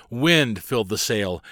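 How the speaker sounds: plain reading voice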